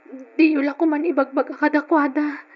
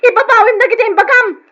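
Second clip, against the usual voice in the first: higher, louder furious demand; angry shout; 7 semitones higher, 8 dB louder than usual. Acted. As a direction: yell at him to take it back. Demanding he take words back. (nagngangalit na sigaw)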